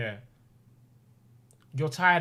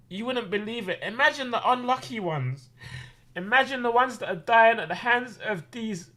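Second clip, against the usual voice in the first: voice breaking with emotion; 6 semitones higher than usual. Real, not acted. Overwhelmed telling of his blessings.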